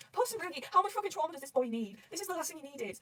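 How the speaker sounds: high-pitched fretting over him; pained sympathy. Real, not acted. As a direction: lament on a poor character's behalf. Fan pained for a suffering character.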